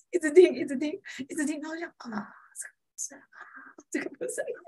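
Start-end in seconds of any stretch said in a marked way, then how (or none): 2.1-4.6 whispering. Retold or imitated. Inner thought during class.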